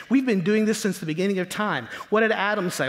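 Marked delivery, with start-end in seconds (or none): none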